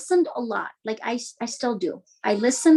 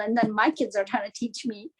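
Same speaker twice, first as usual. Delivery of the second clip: ordinary talk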